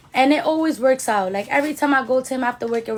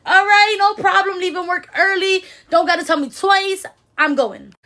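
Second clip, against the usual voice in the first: eager excitement; 6 semitones higher than usual. Retold or imitated. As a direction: jump at the offer, thrilled to hurry over. Eager reply to the salon.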